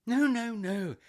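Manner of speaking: high, loud string of denials; hasty reassurance. Acted. Client denying to agency woman anything's wrong, hasty. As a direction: wave the worry away emphatically.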